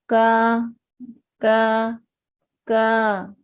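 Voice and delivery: long का with a light front K; thin light kaf. Shown as a demonstration. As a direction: hold का thin and open, mouth not filled. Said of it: "ये बारीक है".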